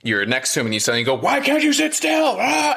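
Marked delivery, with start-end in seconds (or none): 1.2-2.8 mock outburst ending in a sharp cry; sudden scary yell. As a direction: burst out like a parent losing it. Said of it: "how scary and threatening that would be".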